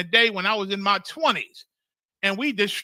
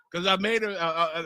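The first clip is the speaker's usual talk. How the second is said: usual speaking voice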